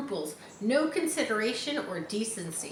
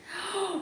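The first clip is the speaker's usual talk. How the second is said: mock gasp; 11 semitones higher, 3 dB quieter than usual. Gasp in read-aloud.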